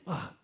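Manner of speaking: scoffing grunt of disgust; dismissive annoyed scoff; annoyed ugh. Shown as a demonstration. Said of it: "Don't even make that ugh sound".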